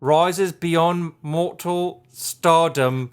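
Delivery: very slow, grand mock British; British accent. Host reads lofty label copy, hammed-up British accent. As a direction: stretch each word in hammed-up British. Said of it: "with a British accent".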